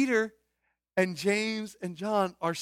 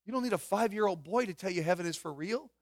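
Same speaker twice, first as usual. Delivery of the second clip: ordinary talk, nothing marked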